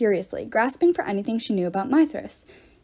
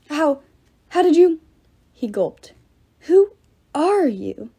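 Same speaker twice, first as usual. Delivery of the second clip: stunned stammer; 5 semitones higher, 5 dB louder, slower than usual. A narrator's reading. Pater stunned and gulping.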